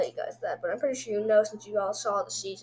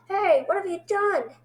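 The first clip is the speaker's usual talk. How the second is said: raised, higher-pitched imitated protest; mock outraged accusation; 8 semitones higher, 4 dB louder than usual. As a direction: call them out in mock outrage. Voicing the pair's accusation.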